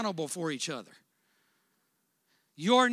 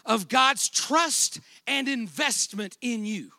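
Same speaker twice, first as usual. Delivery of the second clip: preaching; 6 semitones higher, 9 dB louder than usual. Acted. Preaching at full force.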